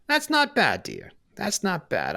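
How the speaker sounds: put-on unimpressed old lady shrugging it off; tough old woman. Old brawler mocking after punch.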